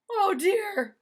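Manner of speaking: high, loud oh dear; dismayed exclamation. Acted. Missus Rogers reacting to the trimmed steak, loud high dismay. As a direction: sigh it out loudly in dismay.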